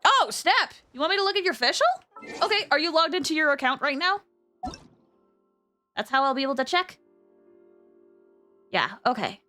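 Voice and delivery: ordinary delivery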